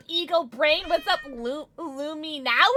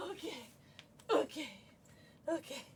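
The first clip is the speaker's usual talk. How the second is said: soft pet talk; 17 dB quieter, faster than usual. Real, not acted. Talking softly to the dog.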